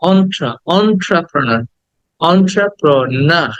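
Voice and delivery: very slow "entrepreneur", "on-tra-pro-na"; open "o" start. Shown as a demonstration. Teacher drills students, slow "entrepreneur" with "অ" onset. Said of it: "প্রথমে এ বলবো না".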